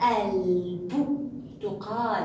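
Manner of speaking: "الب- تقال" broken up slowly for pupils; pronounced lam. Shown as a demonstration. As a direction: hold the ل in الب-, pause, then finish تقال. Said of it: "حرف الباء من حروف اللام القمرية".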